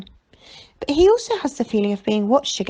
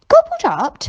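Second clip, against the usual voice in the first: much higher and louder, a startled gasp of disbelief; gasped in shock; 6 dB louder than usual. A narrator's reading. Stan hears the great man got gobbled, gasping aloud. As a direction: blurt it out in alarm. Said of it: "gasps Stan".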